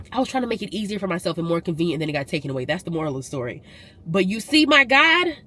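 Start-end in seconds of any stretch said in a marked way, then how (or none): 4.1-5.4 high, loud and slow exclamation; praise. Real, not acted. Vlogger praising God to viewers, high and slow. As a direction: proclaim it like a testimony.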